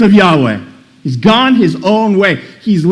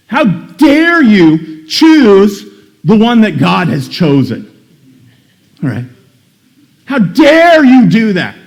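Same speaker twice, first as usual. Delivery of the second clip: much louder, higher and slow, seething; furious Saul; 5 semitones higher, 6 dB louder, slower than usual. Acted. King Saul raging at his son for choosing David, furious shout. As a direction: drag out each word in outrage. Preacher voicing Saul's rage at Jonathan.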